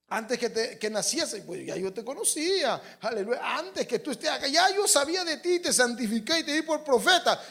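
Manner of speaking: rising to a high, loud proclamation; impassioned divine declaration. Acted. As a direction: declare it as God to a prophet, building. Preacher voices God addressing Jeremiah.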